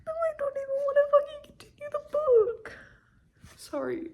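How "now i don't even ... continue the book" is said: tearful, much higher and strained; real crying over a plot twist; choked-up high whimpering voice; crying upset. Real, not acted. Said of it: "I'm so upset".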